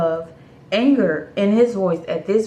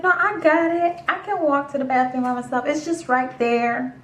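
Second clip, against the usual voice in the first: high, placating brush-off; 7 semitones higher than usual. Retold or imitated. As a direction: pitch it up to decline nicely. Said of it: "not crazy enough to say it out loud".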